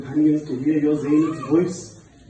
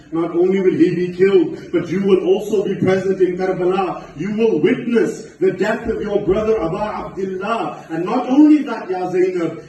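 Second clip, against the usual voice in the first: raised, swelling voice of grim prophecy; impassioned preaching; 5 semitones higher, 4 dB louder than usual. Retold or imitated. Father foretelling Karbala to Zainab, impassioned preaching. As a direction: build the foretelling with rising force.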